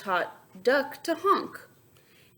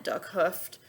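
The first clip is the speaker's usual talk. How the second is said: ordinary delivery, nothing marked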